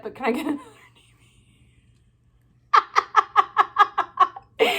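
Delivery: mock-sheepish whispered ask. Wife sheepishly whispering for another TV, mock whisper. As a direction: whisper the request like a guilty wife.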